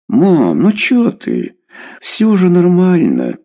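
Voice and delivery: high, drawn-out protest; whining teenager. Acted. Daughter brushing off scolding mother, whiny protest.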